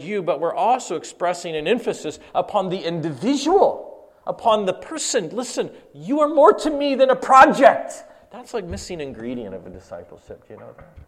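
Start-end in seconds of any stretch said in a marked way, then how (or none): 5.3-7.9 high and loud, pressing the point home; emphatic outburst. Real, not acted. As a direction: make them hear it. Preacher addressing disciple directly.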